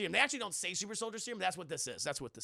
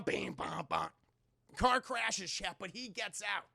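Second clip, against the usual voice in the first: ordinary talk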